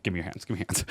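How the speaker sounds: soft, low, repeated request; hushed teacher quote. Retold or imitated. Teacher urging students to give hands, hushed. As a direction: pull the volume and pitch right down.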